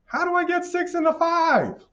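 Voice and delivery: very high-pitched mock question; mock baffled questioner. Voices a puzzled question before answering it.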